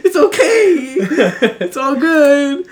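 high, loud, drawn-out squeak; mock nervous reassurance. Worker accepting the customer's apology, mock nervous reassurance. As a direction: imitate a rattled worker, laughing through it.

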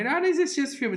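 high whiny mock quote; mocking whine. Mocking an imagined critic.

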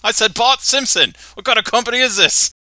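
irritated Australian caller getting the runaround; annoyed Australian caller. Acted. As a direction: snap at the office like an irritated Aussie. [name] angry on phone.

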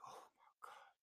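hushed, breath-only gasp; stunned, voiceless exhale; shaken whisper. Retold or imitated. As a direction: whisper it to yourself after a fright. Acted shaken relief, driving away.

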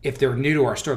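ordinary talk, nothing marked

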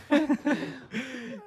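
high burst of laughter; laughing. Real, not acted. Laughing at the joke about washing dishes, a high laugh. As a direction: let a real laugh break out.

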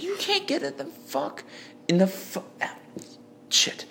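high-pitched imitation of an angry driver; mock angry bus driver. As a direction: squeak out the driver's rant. Bus driver argument voiced.

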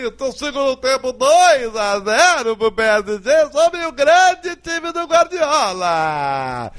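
slow, drawn-out announcer call. Acted. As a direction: stretch every word like a stadium announcer. Announcer-style score call.

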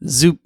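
quick rising zoop; a cartoon machine noise; zoop sound. A narrator's reading. The Replicator three thousand copies Alpaca with a zoop.

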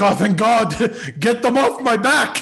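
high, loud cry of relief, breaking into laughs; hammed-up desperate holler with laughter; mock relieved shout. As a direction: yell it out slowly as if finally rescued. Acts out relief at being freed.

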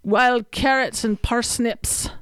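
slow, hammed-up Scottish; muddled Scottish accent. Co-host inventing a Scottish life for her friend, playful Scottish accent. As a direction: stretch it out in a wobbly mock Scottish accent. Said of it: "what the accent was".

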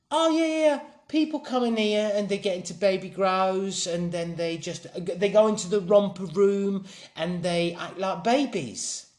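put-on high, chatty London accent; London girl's accent. Girl explains the club to a newcomer, high London accent. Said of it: "They said you weren't gonna do the accent".

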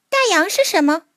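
put-on high, curious child voice; little mole voice. A narrator's reading. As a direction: ask like a small wide-eyed creature. Mole asks the seagull.

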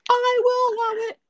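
high posh mock British accent; Queen impression. Imitating the Queen of England in a high British accent. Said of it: "She goes".

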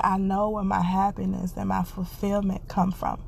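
ordinary delivery

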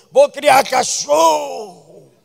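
very slow, loud, high shout; slow, loud mock proclamation; mocking declaration. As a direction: drag out the believers' vow in mockery. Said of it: "quoting believers".

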